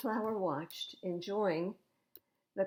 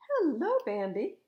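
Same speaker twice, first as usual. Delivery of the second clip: high, louder squeaky greeting; high hello; 6 semitones higher, 5 dB louder than usual. Acted. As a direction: pop up with a bright hello. Skunk greets again.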